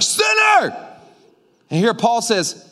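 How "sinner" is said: high, loud accusing yell of sinner; mock accusing shout. A preacher mimics the church yelling sinner, loud accusing shout. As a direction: shout it like a finger pointed at you. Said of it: "people have been like".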